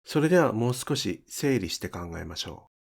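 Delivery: ordinary talk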